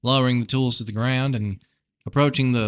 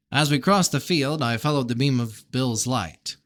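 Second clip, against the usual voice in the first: usual speaking voice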